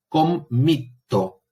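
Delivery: "Committo" said very slowly, with the double consonant drawn out; slow demo. Shown as a demonstration. Teacher showing a doubled consonant to students, said slowly. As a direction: stretch the word so both t's are heard. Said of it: "Cuando yo tengo una consonante duplicada".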